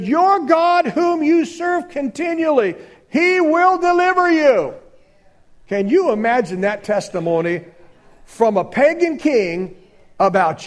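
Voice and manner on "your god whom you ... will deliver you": king's words. Acted. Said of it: "the king came to the den and said".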